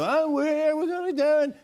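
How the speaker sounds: very high-pitched comic impression; Jerry Seinfeld. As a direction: push it way up high, exaggerated. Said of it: "I'll do an impression of Jerry Seinfeld".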